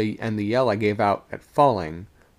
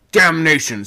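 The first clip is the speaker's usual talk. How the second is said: loud, high burst of anger; angry shout; 8 semitones higher, 11 dB louder than usual. A narrator's reading. Second mate at stammering sailor, angry shout. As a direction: shout the oath in exasperation. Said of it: "shouted the sec-, second mate angrily".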